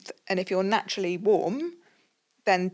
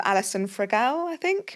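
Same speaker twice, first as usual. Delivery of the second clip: ordinary delivery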